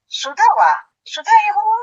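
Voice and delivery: high-pitched, accusing question; jealous demand. Acted. Wife confronting husband about Sudha, jealous demand. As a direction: demand the other woman's identity, voice climbing.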